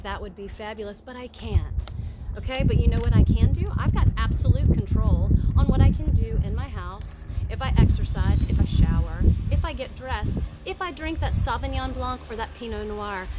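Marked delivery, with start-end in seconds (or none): 2.4-11.3 loud, driving declaration; loud emphatic. Real, not acted. Host rallying her viewers, loud and driving. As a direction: pound home every point.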